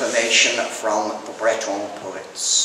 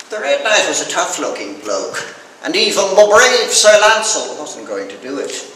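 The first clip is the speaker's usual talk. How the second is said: ordinary delivery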